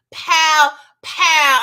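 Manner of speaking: two loud punchy pows; mock gunshots. Retelling Sissy's shooting of Teddy, loud pow pow. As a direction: spit out the shots like a kid playing guns.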